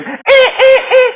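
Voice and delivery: very high, loud dolphin clicking squeak. Pretend. Raver on dolphin pills squeaking like a dolphin.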